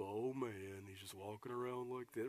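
low, quiet, mopey donkey drone; Eeyore impression. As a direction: sag into a gloomy Eeyore moan. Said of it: "the Eeyore off Winnie the Pooh".

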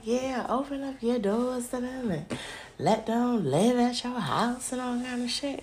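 mock naive people. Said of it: "the people were like".

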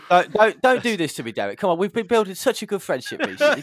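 begging Derek not to spoil it, hammed-up high plea; mock pleading. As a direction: ham up a hurt plea to a friend.

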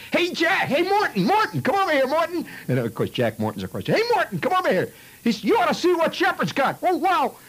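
put-on high, loud kid's shout; calling across the street. Kid yelling for Morton across the street, loud and high. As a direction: holler to a friend far away.

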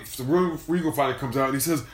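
ordinary talk

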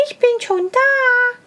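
high, calling wife voice. A narrator's reading. The wife calls to the arriving hare, high-pitched. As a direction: call out high and bright as the wife. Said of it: "rief ihm dem Igel seine Frau entgegen".

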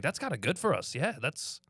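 mock Irish fan, half pleased; Irish fan accent. Irish fan gloating over Jimmy Butler's injury, mild Irish accent. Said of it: "He's like".

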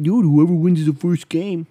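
hot-take sports fan. Acted. Mimicking sports-show hosts' worst take, mock excited. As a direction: blurt it out like a bad sports-take host. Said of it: "they always say that".

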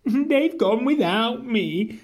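storybook cry of dismay; upset cry. A narrator's reading. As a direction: cry it out, left behind. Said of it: "And he cried".

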